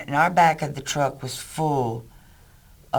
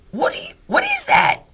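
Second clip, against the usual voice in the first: squeaky, loud mock shriek of alarm; hammed-up freak-out at seeing a baby; panicked Doug; 6 dB louder than usual. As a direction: friend spotting the baby in the truck, mock screech. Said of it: "nervous like he is".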